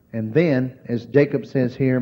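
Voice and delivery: ordinary talk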